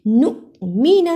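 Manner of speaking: نؤمن with a small glottal stop on the hamza; glottal catch. Shown as a demonstration. Teacher shows students the hamza, small glottal catch. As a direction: give the ؤ of نؤمن a light catch. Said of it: "چھوٹا سا جھٹکا لگایا".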